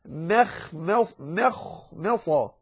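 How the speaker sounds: very slow مخمصة, light M, heavy خ and ص; light meem. Shown as a demonstration. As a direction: part the syllables of مخمصة, Ms thin. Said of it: "لأنها مرققة ثم".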